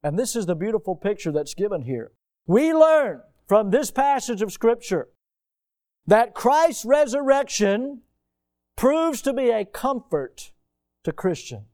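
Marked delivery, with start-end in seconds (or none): 2.5-11.6 slow, lifted preaching cadence. Acted. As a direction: lean into each phrase like a preacher. Preaching the resurrection passage.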